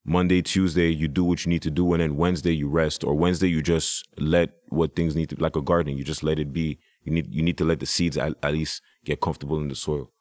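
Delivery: usual speaking voice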